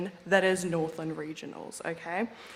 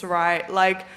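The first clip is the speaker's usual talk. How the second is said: ordinary talk, nothing marked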